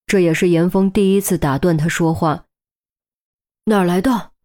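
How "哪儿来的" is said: urgent. A narrator's reading. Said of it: "语调急促了许多".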